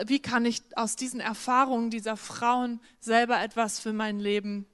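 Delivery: ordinary delivery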